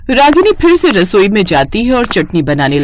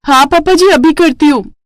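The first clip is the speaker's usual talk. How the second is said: higher, brightly obliging; eager compliance; 7 semitones higher than usual. Acted. New bride answering father-in-law, higher and eager. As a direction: chirp a quick yes.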